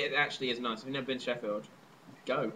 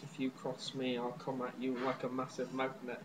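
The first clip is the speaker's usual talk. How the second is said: a hint of a hopeless, menacing Manchester accent, much softer; mock Manchester accent; 3 semitones lower, 10 dB quieter than usual. Shown as a demonstration. Trying out a Manchester accent for his friend, lightly done. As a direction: give up hope on life in your voice, Manchester style. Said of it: "so I'm gonna sound like".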